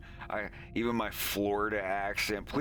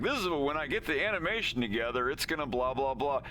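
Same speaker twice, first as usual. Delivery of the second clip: fast, higher, breezy mockery; mocking voice; 6 semitones higher, faster than usual. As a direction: rattle off empty promises. Mocking their promises.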